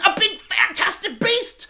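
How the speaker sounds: very high, slow exclamation; mock grand reveal. Reviewer mocking the prison plot, hammed-up reveal. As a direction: squeal it as a silly big reveal.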